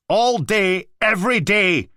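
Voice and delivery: high, loud angry yell; furious shout. Acted. Blakey shouting at the Mantis about the noise, furious. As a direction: tear into him.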